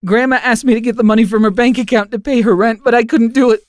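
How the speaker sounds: put-on a boy confessing through tears, voice high and strained; tearful confession. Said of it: "The tears started flowing again".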